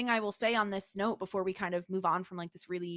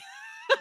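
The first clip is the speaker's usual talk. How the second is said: laugh. Real, not acted. Laughing at silly plot.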